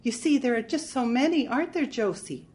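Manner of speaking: put-on girlish and breathless, pitched well up; breathless little girl. A narrator's reading. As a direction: plead like a helpless, tearful little girl. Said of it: "Her voice was breathless, like a little girl's".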